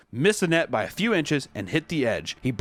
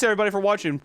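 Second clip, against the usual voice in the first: normal talking voice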